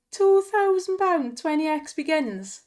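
high, loud excited shout. Real, not acted. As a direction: burst out with excitement. Big slot win excitement.

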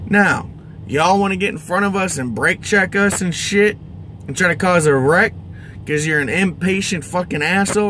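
ordinary talk, nothing marked

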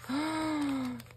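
long, high, soft gasp; delighted gasp. Real, not acted. Finding an envelope in the gift, a delighted gasp. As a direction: gasp at a happy surprise.

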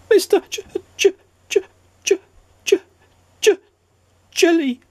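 very high, stuttering J over and over; stammering. A narrator's reading. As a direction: stammer out the name in fright. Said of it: "stammered Mister Jelly".

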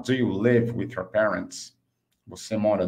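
ordinary talk

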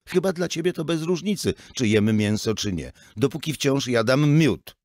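high, loud heated argument; heated retort. Acted. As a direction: fire back at the father. Son argues with father. (syn ostro odpiera zarzuty ojca)

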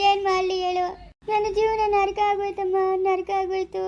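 wailing, sobbing as she pours out her grief; crying lament. Acted. Daughter sobbing to her mother that her life is ruined, wailing.

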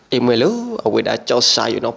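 ordinary talk

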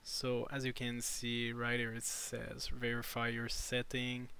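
usual speaking voice

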